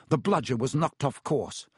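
plain reading voice

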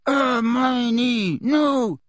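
high, drawn-out gagged shout; muffled shout. A narrator's reading. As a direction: shout as if through a gag. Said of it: "shouted Ron through his gag".

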